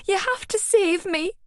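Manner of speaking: high frantic pleading; desperate plea. Acted. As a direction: beg him to save you. Clinging to him, pleading.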